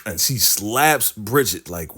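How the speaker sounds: ordinary talk, nothing marked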